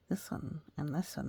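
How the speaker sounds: plain reading voice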